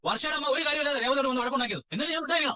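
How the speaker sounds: high, indignant accusation; angry protest. Acted. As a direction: protest the blame, pitch way up. Quarrel continues. (ഉയർന്ന സ്വരത്തിൽ രോഷം)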